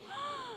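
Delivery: sharp high gasp of mock outrage; a sudden theatrical gasp; shocked gasp. Real, not acted. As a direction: gasp as if scandalised. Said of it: "clueless about Clueless".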